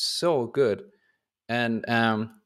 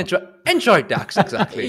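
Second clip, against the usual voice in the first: laughing; 7 semitones higher, 4 dB louder than usual. Real, not acted. Host laughs at the joke.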